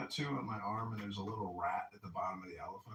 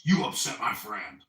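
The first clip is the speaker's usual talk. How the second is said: booming accusation; mock angry bouncer; loud bouncer accusation; 11 dB louder than usual. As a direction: lay the blame on him, loud.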